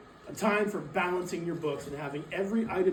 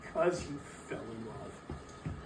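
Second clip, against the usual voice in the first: lower, softer and slow, with a scornful chuckle; scoffing chuckle at the nephew's excuse; mocking laugh; 7 semitones lower, 7 dB quieter, slower than usual. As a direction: sneer through a laugh at the idea. Scrooge mocks nephew's reason.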